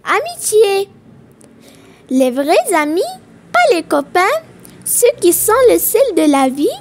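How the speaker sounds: loud, measured verse reading with pauses between lines; poem recitation. Acted. Teacher reads a friendship poem to pupils, projected recitation. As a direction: recite it line by line, projecting clearly.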